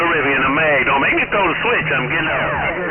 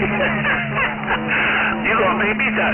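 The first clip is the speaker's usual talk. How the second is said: laughing; much slower than usual. Real, not acted. Laughs at the cool radio voice.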